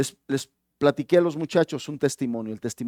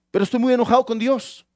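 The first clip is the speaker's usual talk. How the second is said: higher, louder protest; acted anger; 7 semitones higher, 4 dB louder than usual. Man insisting to the pastor he is angry with God. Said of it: "se siente el hombre muy enojado".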